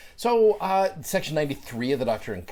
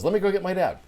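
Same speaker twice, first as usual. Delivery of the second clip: put-on higher, louder kid's voice; little boy voice; 9 semitones higher, 6 dB louder, faster than usual. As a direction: chirp it up like a five-year-old boy. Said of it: "he goes".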